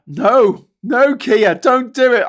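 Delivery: high, loud desperate no; mock plea. An aide begging the Prime Minister, loud mock panic. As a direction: beg him to stop, hammed-up. Pleading with PM in skit.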